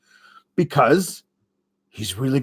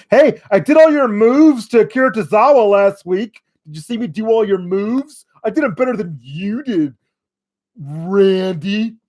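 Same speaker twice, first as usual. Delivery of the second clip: cocky taunt; 5 semitones higher, 3 dB louder than usual. Retold or imitated. Acting AJ taunting Randy.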